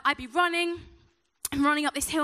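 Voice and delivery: ordinary delivery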